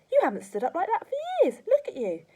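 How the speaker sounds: put-on high, loud boyish amazement; excited cry. A narrator's reading. George marvelling at Grandma standing, excited cry. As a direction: exclaim it with rising delight. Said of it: "George cried".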